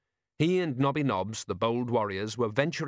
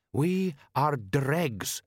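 slow, drawn-out reproach, pitched higher; reproachful; 4 semitones higher, much slower than usual. A narrator's reading. Jabbar reproaching the others about who they are, reproachful. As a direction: reproach them, stretching each word slowly. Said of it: "said Jabbar reproachfully".

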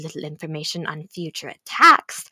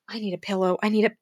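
ordinary talk

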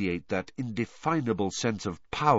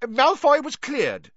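high, loud indignant rebuttal; offended official, voice raised; affronted; 6 dB louder than usual. A narrator's reading. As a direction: snap back, affronted. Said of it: "visibly affronted".

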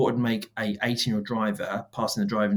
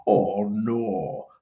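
very slow, drawn-out mascot voice with a different timbre; cool cartoon bulldog voice. Shown as a demonstration. Pitching the mascot voice to the client, slow and cool cartoon dog. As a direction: stretch out each word like a lazy, cool cartoon dog. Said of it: "this really cool voice that kinda goes".

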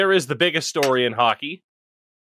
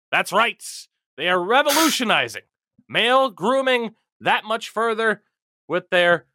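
ad read; 9 semitones higher than usual. Acted.

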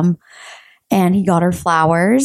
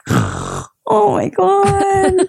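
laughing disbelief; 11 semitones higher, much slower than usual. Retold or imitated. Reacting to drunk friend's question.

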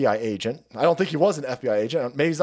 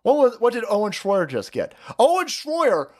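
ordinary delivery

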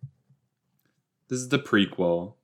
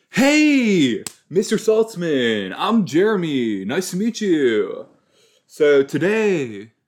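put-on much higher and louder, slow teen greeting; mock Jeremy; 7 semitones higher, 6 dB louder, slower than usual. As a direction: ham up an eager kid introducing himself. Mocking Jeremy's loud introduction.

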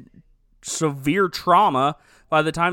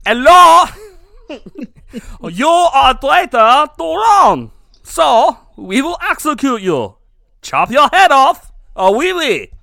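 loud, higher hammed-up French guard; mock French accent; 8 semitones higher, 13 dB louder than usual. French guard sentencing Paul, hammed-up loud French accent. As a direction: overplay a French accent, sentencing him cheerfully. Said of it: "that's how he talks".

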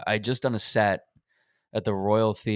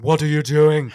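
much louder, higher furious celebrity-chef yell; Gordon Ramsay yelling; 6 semitones higher, 11 dB louder than usual. Retold or imitated. Chef bursting out of the kitchen at the owner, a furious impression shout. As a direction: explode at the staff like a TV chef. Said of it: "Gordon Ramsay stepped out from the back and went".

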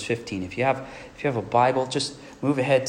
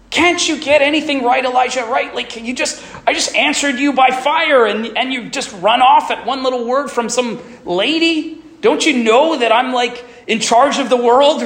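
loud, much higher exasperated yell; mock yelling; 13 semitones higher, 9 dB louder than usual. God imagined yelling at Elijah, exasperated shout. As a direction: yell at him in disbelief. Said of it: "He's not yelling at Elijah".

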